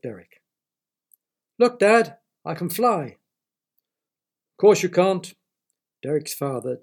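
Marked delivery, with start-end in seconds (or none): 1.6-3.2 excited shout up to dad; calling down to his father, thrilled; child calling out. Acted. Boy shouting to his dad in the garden, excited shout. 4.6-5.3 curt, loud brush-off; dad not bothering to look up; dismissive dad. Acted. Father cutting grass answers his son, dismissive.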